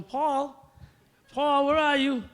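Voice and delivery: high, loud call through the house; imitated mother calling. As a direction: impersonate a mom summoning her son. Mother calling him from downstairs.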